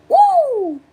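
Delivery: very high whoops, one after another; playful high-pitched whoops; repeated falsetto woo. Pretend. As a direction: hoot falsetto woos that slide down.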